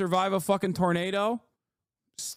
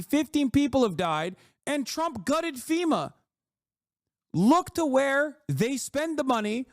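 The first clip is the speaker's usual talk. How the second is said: much higher, heated rant at the viewer; angry rant; 8 semitones higher than usual. Real, not acted. Streamer berating American viewers over cuts, high angry rant. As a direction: lay into them, furious and pitched high.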